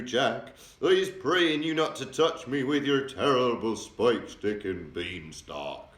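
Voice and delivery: loud, slow, begging wail; the giant begging Jack to spare him; pleading giant scream. A narrator's reading. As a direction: plead for mercy with all his might. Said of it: "screamed the flesh lump eater".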